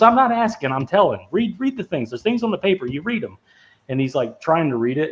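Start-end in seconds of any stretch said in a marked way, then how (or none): 0.0-3.4 high, sharp barking detective voice; mock stern detective. Detective snapping at the driver to read, high and sharp. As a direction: bark the order like a fed-up cop. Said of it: "He goes".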